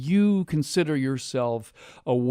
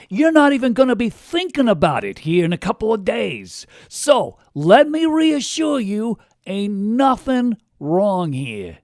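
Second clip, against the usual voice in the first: higher, hammed-up Queens accent; 5 semitones higher than usual. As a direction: play the future as a brash guy from Queens with a Queens accent. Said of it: "the future has an accent from Queens".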